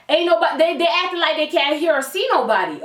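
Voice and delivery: ordinary talk, nothing marked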